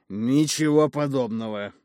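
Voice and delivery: slow, loud, flat refusal; categorical. Acted. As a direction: refuse with blunt finality. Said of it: "категорично помотал бородой Эпимас".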